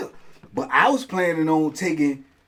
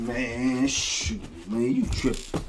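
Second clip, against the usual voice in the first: muttered dismissal; 5 semitones lower, 7 dB quieter, much slower than usual. Retold or imitated.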